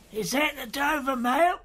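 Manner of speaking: high, thin far-off calling voice; distant call. A narrator's reading. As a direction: call out from away in the mist. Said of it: "A man's voice called from the mist".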